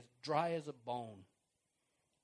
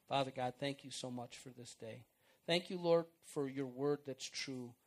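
closing prayer; 4 semitones lower than usual. Acted.

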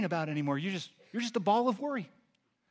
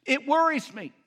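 fretting matriarch; 4 semitones higher, 7 dB louder than usual. Retold or imitated. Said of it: "She says to me".

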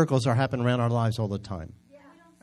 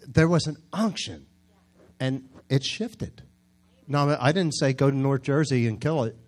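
ordinary delivery

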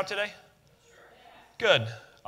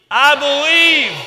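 much higher, louder, stretched-out shout; louder group shout; 8 semitones higher, 12 dB louder, much slower than usual. Real, not acted. Preacher asks the church to shout it louder. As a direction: really belt it out together. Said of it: "let's try this again".

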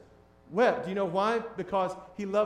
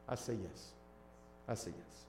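hushed; 10 semitones lower, 19 dB quieter than usual. Real, not acted. Quiet affirmation at sermon's close.

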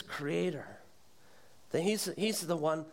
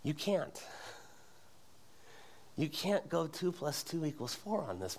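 ordinary talk, nothing marked

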